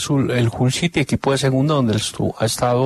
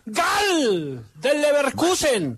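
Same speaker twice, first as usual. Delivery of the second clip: high, thrilled shout at a sudden goal; excited goal call; 12 semitones higher, 3 dB quieter, much slower than usual. Real, not acted. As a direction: burst out as the goal goes in.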